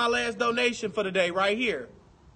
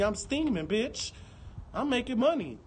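normal talking voice

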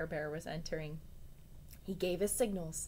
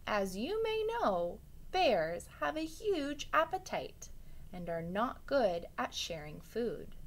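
usual speaking voice

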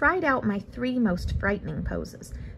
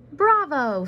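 higher and louder praise; proud lion bravo; 6 semitones higher, 6 dB louder than usual. A narrator's reading. Lion praising his pupil, higher and louder. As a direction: cheer the pupil on, pitched up and loud.

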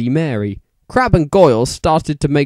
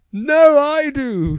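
much higher, slow, squeaky protest; shouted; 13 semitones higher, slower than usual. A narrator's reading. Vampire shouting at Draco in a squeaky voice. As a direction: squeal the claim out slowly.

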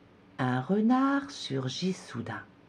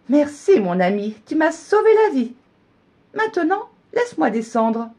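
high, loud grateful thanks; relieved gingerbread man; 12 semitones higher, 7 dB louder than usual. A narrator's reading. Relieved gingerbread man thanking the fox, high and loud. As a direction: thank your rescuer eagerly.

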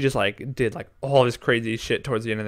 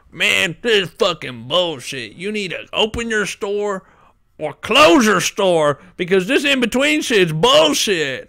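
higher, louder fake rant; mock angry caller; 8 semitones higher, 5 dB louder than usual. Coworker mocking an angry customer on the phone, ranting loud. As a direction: ham up a pissed-off customer complaining. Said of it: "making fun of this guy who called in and was pissed off".

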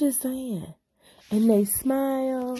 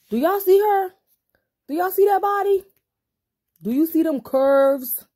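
high squealing hype, louder; excited gushing; 8 semitones higher, 6 dB louder, faster than usual. Real, not acted. Hyping up a sticker figure.

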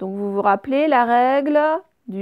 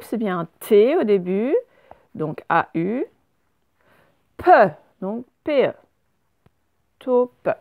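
ordinary talk

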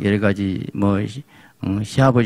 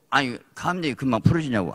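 ordinary talk

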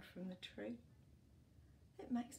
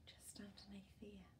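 whisper; 11 dB quieter than usual. Real, not acted. Pointing at picture.

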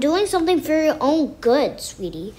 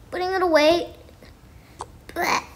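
ordinary talk, nothing marked

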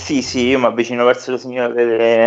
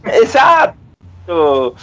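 loud, high, very drawn-out cheer; real gleeful outburst; exultant exclamation; 4 semitones higher, 4 dB louder, much slower than usual. Real, not acted. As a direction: crow it out, stretched slow. Player exults, others laugh.

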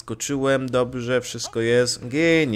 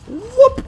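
impact noise; 11 dB louder than usual. Real, not acted. Gamer voicing an impact noise.

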